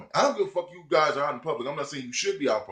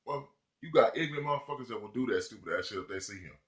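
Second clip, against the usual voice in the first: ordinary delivery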